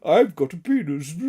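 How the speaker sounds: silly put-on man's voice; hammed-up fake deep voice; mock deep voice. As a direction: imitate someone faking a deep voice. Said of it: "like, a deep voice".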